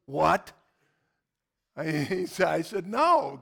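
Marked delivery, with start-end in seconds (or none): 0.1-0.5 sudden loud "what?"; stunned disbelief. Retold or imitated. Gym guy to preacher after refusal, loud disbelief. 2.9-3.4 high, loud, firm no; emphatic refusal. Retold or imitated. Preacher refusing the gym guy's request, emphatic.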